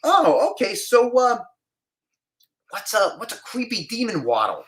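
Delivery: casting guy interviewing the actor, high and smarmy; sleazy casting guy. Retold or imitated. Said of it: "He goes".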